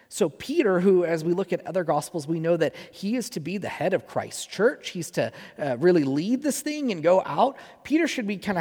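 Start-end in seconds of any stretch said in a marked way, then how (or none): none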